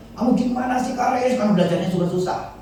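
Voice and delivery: high scolding mother voice. Retold or imitated. Pastor imitating mother scolding son Karis, high scolding. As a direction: play the exasperated mother nagging her son. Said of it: "sering dihajar".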